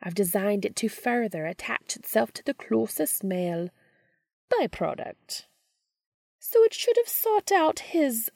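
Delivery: high crooning witch. Acted. Witch voice in fanfic reading.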